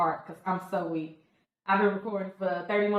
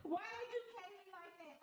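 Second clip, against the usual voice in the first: very high, thin wail fading softer; about to cry; 3 dB quieter than usual. Real, not acted. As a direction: squeak it out on the verge of tears. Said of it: "I'm gonna start crying".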